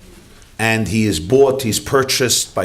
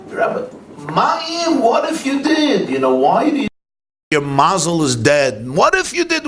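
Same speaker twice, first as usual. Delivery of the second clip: much higher, pressing insistence; excited rabbi; 8 semitones higher than usual. Retold or imitated. Rabbi urging the poor man he may have won, in a much higher excited voice. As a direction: squeal it up in eager disbelief.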